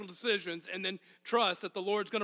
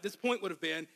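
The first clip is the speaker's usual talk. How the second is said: usual speaking voice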